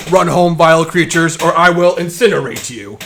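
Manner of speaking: loud, booming threat at the pitch of normal talk; threatening Warforged. Acted. Weld from the Forge throwing children aside, loudly threatening. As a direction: order them off with menace. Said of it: "it's Weld from the Forge".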